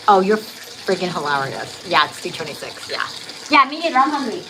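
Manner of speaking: ordinary talk, nothing marked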